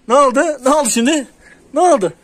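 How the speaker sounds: loud, high ne oldu repeated; high worried asking. Real, not acted. Owner anxiously asks the cat what happened, high and loud.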